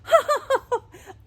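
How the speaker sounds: high, loud shriek of laughter. A narrator's reading. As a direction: shriek with laughter as the old woman. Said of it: "shrieked with laughter".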